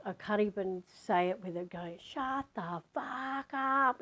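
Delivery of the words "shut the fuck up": slow, high-pitched yell stretched out; retold furious roar. Yelling at the screaming housemate, a slow drawn-out roar. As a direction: relive the roar that silenced the house. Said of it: "I remember yelling out".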